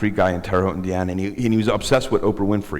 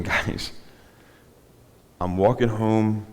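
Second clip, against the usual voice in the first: ordinary talk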